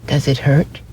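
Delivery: high, timid little rabbit; a hint of a worried rabbit; small rabbit voice. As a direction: ask it like a shy toy rabbit. Rabbit asks.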